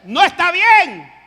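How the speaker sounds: put-on slow, loud, high warning to a child. Parent scolding a child who keeps misbehaving, loud and slow. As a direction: raise your voice at a disobedient child. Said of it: "Ya va subiendo la voz".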